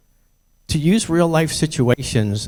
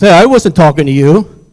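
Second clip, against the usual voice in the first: loud, higher-pitched rebuke; acted-out hostile comeback; confrontational bully; 5 semitones higher, 8 dB louder than usual. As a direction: tell off someone butting in. Acted bully snaps at the speaker.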